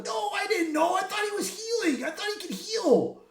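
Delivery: high shouted excuse in a put-on voice; defensive shout. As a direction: yell a frantic excuse. Deadpool protests the fatal mistake.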